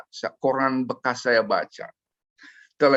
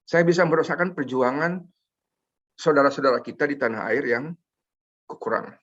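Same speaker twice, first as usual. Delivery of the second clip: emotional; 3 semitones higher than usual. Real, not acted. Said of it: "Maaf, saya emosional ya".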